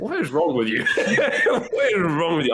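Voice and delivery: laughing disbelief, slowing down; half-laughing, teasing friends; friends mocking. Imitating friends mocking his screaming, laughing. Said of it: "My friends were just like".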